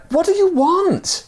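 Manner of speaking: high, loud demand; frustrated yell. Acted. Man yelling down again, high frustrated yell. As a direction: shout it down in frustration. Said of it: "yelled to him again".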